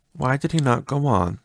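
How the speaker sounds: plain reading voice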